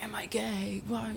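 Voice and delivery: breathless whisper of doubt; hushed anxious self-question; whispered inner thought. Retold or imitated. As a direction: whisper it to yourself.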